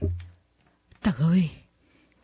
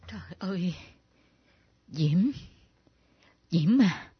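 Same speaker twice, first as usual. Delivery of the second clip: breathless whispered gasp; shocked whisper; 4 semitones lower, 6 dB quieter than usual. Acted. Mother whispering in shock at Diễm's ghost.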